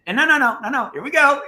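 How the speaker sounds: high, loud no no no; squealing no, here we go; excited protest. Real, not acted. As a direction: laughingly protest the tease. Protesting being teased.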